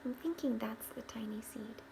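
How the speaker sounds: soft whispered aside. Real, not acted. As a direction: whisper it as if sharing a secret. Whispered aside to listening children.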